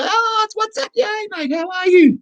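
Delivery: high, bright mock greeting, ending in a shout; excited greeting. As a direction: play the overjoyed caller picking up. Mimicking excited phone greeting.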